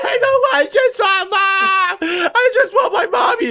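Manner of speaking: very high, loud mock crying; hammed-up wail of a small child; Jonathan screaming, terrified. Terrified four-year-old crying for his mother, imitated screaming. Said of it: "Jonathan is screaming. He is so terrified".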